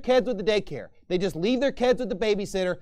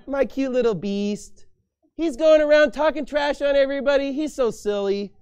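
high, loud cooing over a pet; mocking singsong of a naive believer; mock doting voice; 7 semitones higher, 6 dB louder than usual. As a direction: gush like a fool over a cute pet.

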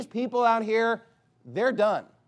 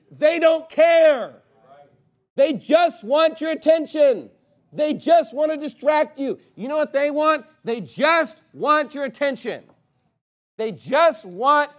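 higher, louder, slow and driving; fiery preaching; 7 semitones higher, 7 dB louder, slower than usual. Real, not acted. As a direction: hammer it home from the pulpit. Preacher hammering his point.

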